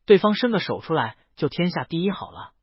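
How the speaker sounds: ordinary delivery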